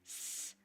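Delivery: "s-" hissed with the tongue on the hard palate; S sound demo. Shown as a demonstration. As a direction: hold a short "s-" made against the palate. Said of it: "when I make this S sound right here".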